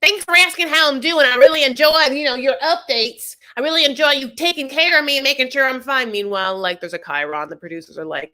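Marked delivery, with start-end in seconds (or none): 0.0-6.1 high, put-on sarcastic complaint; [name]. [name] thanking Michael for asking after her, sarcastically. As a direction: lay on mock gratitude, pitched high.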